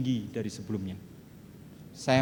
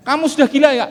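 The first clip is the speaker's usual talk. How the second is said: scolding boss; 10 semitones higher, 11 dB louder than usual. Retold or imitated.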